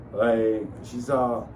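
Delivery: normal talking voice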